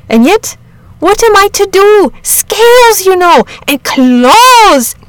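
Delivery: high, loud protesting countryman; indignant, rattled rustic outburst; distressed shepherd. A narrator's reading. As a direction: wail about the beast, then put the foot down.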